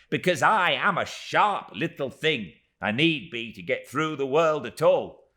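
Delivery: high, loud, pressing little voice; agitated Miss Moucher. Acted. Miss Moucher explaining to David, agitated and high. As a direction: pour out the whole story in a rush of grievance.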